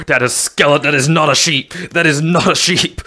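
loud, high-pitched startled yell; alarmed shout. Real, not acted. Startled by skeleton instead of sheep.

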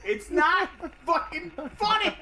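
high, drawn-out objection; exasperated protest. Real, not acted. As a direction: stretch each word out, pitch way up. Arguing back that joke is unfunny.

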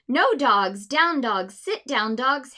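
loud commands to the dogs; scolding dogs. Acted. Owner in the story shouting commands at the dogs. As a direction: order the dogs down firmly.

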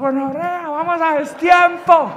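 high, slow, strained complaint; a friend complaining they're off rhythm, high and slow; mock frantic friend. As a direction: whine it up high and drawn out. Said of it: "hasta los zurdos".

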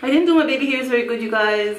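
ordinary delivery

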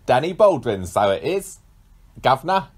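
loud, chirpy Cockney geezer; bad Cockney impression; Cockney accent. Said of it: "I definitely can't do that one".

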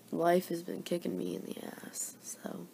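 whisper. Real, not acted. Tired morning confession.

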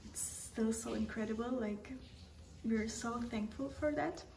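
soft whispered narration, voice barely there; hushed traveller's whisper, confiding to the camera; whispered vlog. Real, not acted. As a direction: whisper so as not to disturb anyone nearby.

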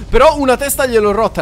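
ordinary delivery

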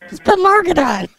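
high-pitched, flustered indignation; indignant. Acted. Caller insists they are the neighbor, indignant. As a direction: stammer into an irritated demand. Acted quarrel on prank call.

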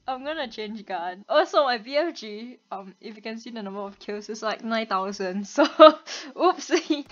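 ordinary delivery

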